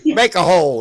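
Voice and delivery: loud, high barked order; navy bark. Pretend. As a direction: bark it like a bosun clearing the deck. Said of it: "That's Navy talk".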